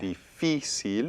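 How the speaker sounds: difícil slowed, second i stressed; slow difícil, weight on the second i. Shown as a demonstration. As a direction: draw it out and lean on FÍ. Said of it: "we need an accent on there, on the I".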